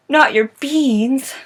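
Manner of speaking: high, loud, drawn-out dismay; mock horror. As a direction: exclaim slowly in theatrical shock. Hero gasps at the theft.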